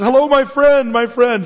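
high, loud mock Arabic greeting "Wallah, Habibi"; playful Arabic-accented hail; Middle Eastern greeting. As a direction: call out like a friendly street greeting in the Middle East. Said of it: "that's just".

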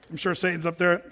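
ordinary delivery

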